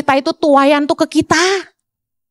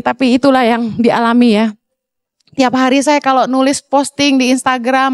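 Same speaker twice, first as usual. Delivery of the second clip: normal talking voice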